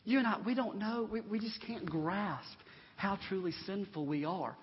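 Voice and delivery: usual speaking voice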